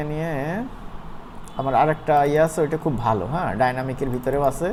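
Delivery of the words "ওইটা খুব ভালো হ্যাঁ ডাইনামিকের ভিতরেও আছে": usual speaking voice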